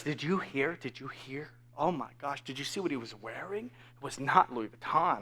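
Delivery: very soft, low gossip; imitating whispering onlookers; whispering bystanders. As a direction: pass on scandal in a hushed undertone. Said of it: "bystanders are, are whispering".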